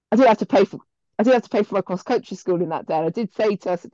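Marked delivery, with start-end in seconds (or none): none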